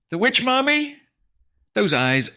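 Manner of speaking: high, loud disbelief; horrified. A narrator's reading. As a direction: cry out in horror at the sight. Horror at seeing the villain.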